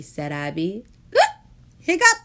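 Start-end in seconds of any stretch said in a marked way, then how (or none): 1.8-2.2 high, loud gasped hiccup; gasping hiccup. A narrator's reading. Jonah hiccups during the blessing, high gasp. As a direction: gasp then squeak a hiccup.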